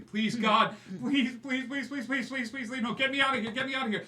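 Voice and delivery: high, loud frantic begging; screaming pleas. Acted. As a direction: beg for your life as they drag you off. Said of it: "you hear this voice screaming behind you".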